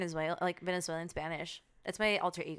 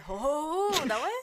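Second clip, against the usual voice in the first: Santa laugh; 9 semitones higher, 6 dB louder than usual. Retold or imitated. Mock French laugh.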